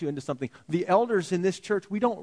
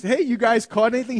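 calling out; 7 semitones higher, 4 dB louder than usual. Retold or imitated. Jesus calling to fishermen again.

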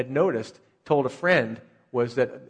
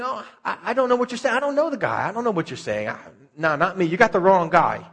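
higher-pitched, hasty brush-off; mock flustered denial; 5 semitones higher, 4 dB louder, faster than usual. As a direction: play a man dodging an accusation in a raised voice. Acts out Peter denying Jesus.

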